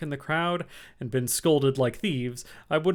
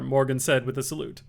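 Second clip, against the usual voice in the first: ordinary delivery, nothing marked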